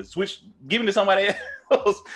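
breaks into a laugh mid-line. Retold or imitated. Customer asking the agent for someone else, laughing. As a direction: laugh through the request. Laughing while retelling a phone call.

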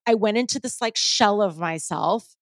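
ordinary delivery

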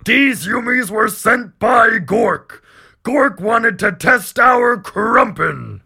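slow, high, loud orc proclamation; bellowing orc. Acted. Orc captain proclaims his god's test, bellowing. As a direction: shout each word out like an orc prophet. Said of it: "he turns to bellow".

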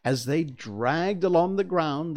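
usual speaking voice

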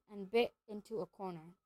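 usual speaking voice

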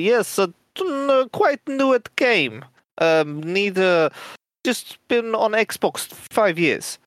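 put-on high-pitched, halting German or Polish accent; German or Polish twang. Newcomer feigning little English to other players, in a high German-Polish twang. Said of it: "like, a German twang or a, like, a Polish twang".

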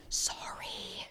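secretive, barely audible whisper; acted-out furtive whisper; whispered apology. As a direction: mouth it behind someone's back. Secretly apologizing behind the boss's back.

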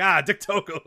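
high loud cry; excited shout. Retold or imitated. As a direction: call the name out. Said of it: "Someone was like".

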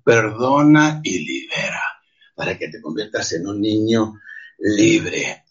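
slow hushed whisper. Real, not acted. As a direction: breathe it out slowly like a secret. Intimate plea to forgive. (susurro lento e íntimo)

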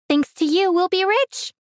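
gloating. A narrator's reading. Cousin taunts captive about getting rich, gloating. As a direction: pitch climbing high in glee.

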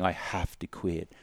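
doom and gloom refusal; gloomy and down. Shown as a demonstration. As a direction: sigh it out like a loss. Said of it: "your whole body language and the tonality is down".

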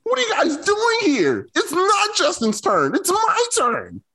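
high, slow, whiny wounded athlete voice, rising to a shout; hurt athlete impression. Cohost mocks booed Andy Dalton in a high, slow whine. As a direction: whine it out like a benched star demanding his turn. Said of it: "[name], do Andy Dalton".

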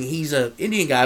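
usual speaking voice

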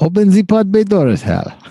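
mock Russian accent, leaning on bay; terrible Russian accent. Repeating the HAL line in a bad Russian accent. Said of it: "That was a terrible Russian accent".

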